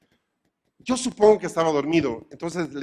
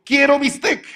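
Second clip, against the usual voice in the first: much higher, louder request; 10 semitones higher, 8 dB louder than usual. Retold or imitated. Customer back at the butcher, loud and high. Customer asking again next week.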